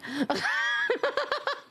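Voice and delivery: high burst of laughter; real laugh. Real, not acted. Streamer laughs high at forgetting raid messages.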